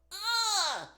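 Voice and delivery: high, loud ugh of pain; a sharp cry as the couch lands; pained grunt. Retold or imitated. Couch dropped on injured foot.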